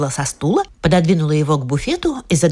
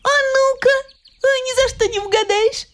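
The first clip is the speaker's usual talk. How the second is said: put-on little girl voice.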